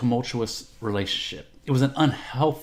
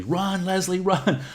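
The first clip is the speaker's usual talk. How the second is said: high-pitched urgent call to flee; yelling; 9 semitones higher, slower than usual. Retold or imitated. Retold yell to Leslie from the ground, high and urgent. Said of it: "yelling to Leslie".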